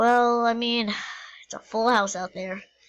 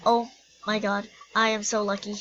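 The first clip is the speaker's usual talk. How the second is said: normal talking voice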